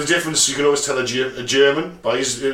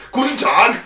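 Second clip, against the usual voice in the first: a mock aggressive German Guten Tag, higher; aggressive German accent; 5 semitones higher than usual. Imitating the German teacher's advice, hammed-up German accent. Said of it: "German accent is sound aggressive".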